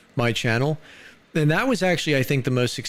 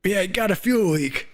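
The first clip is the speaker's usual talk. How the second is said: put-on high, creaky old codger; old man voice; 9 semitones higher than usual. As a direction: play a rickety old timer. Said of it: "is that the old man voice".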